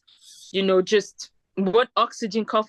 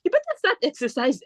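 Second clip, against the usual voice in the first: Nigerian auntie lecture; 3 semitones higher than usual. Retold or imitated. Shoe seller lecturing.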